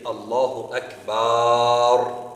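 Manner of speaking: very long, loud "baar" in "Akbar"; slow, sustained A after the b of "Akbar"; elongated final vowel. Shown as a demonstration. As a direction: hold the last A of "Akbar" for ages. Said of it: "They elongate the alif after the ba".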